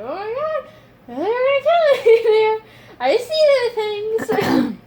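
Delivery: high, loud mock terror; panicked shout. Retold fear of being killed.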